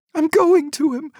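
mother insists on reaching her son, desperate; desperate cry. A narrator's reading. Mother breaks free for son.

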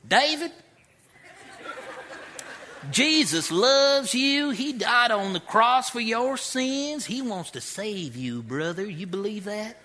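high, loud and slow mock preacher drawl with a laugh; imitating caveman's accent. The caveman inmate preaching to young David in a hammed-up accent. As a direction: ham up the earnest prison evangelist, slowly. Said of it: "you got a firme accent".

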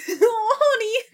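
high laughing sympathy; laughing coo. Real, not acted.

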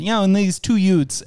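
lightly imitated New Yorker saying "Utes" for youths; Joe Pesci. Actor chatting to his director, lightly imitated New York accent. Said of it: "where Joe Pesci said".

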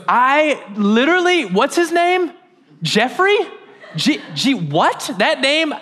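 very high, hammed-up bewildered denial; feigned ignorance. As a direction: stammer through a badly faked blank on the name. Peter pretends not knowing Jesus.

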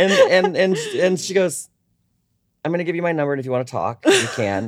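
high, laughing through the words. Real, not acted. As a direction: keep the laugh in your voice. Retelling while still laughing.

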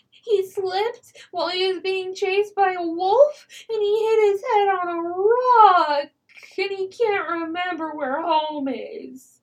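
put-on high, slow child voice cracking into a sob; child fakes tears to fool the hobgoblins; lost child near tears. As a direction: act like a little kid about to cry. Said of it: "pretend to be a lost child".

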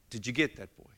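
mother holding her son's face, speaking gently; soft, earnest mother. Retold or imitated. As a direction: speak gently to a small child. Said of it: "she said".

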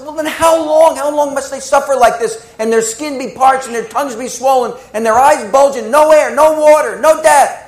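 high, rising desperate pleading; beg, building to a cry; anguished plea. Retold or imitated. Sister pleading with the gatekeeper for the suffering souls.

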